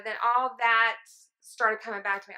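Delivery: ordinary delivery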